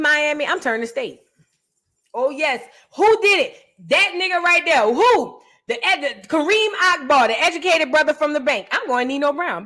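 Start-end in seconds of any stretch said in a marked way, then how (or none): 2.1-9.6 pointing him out high and loud; eager snitch. Pretend. Snitch playing witness to the feds, eager and loud. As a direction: snitch gleefully naming him, louder and higher.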